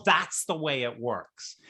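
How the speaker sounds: ordinary talk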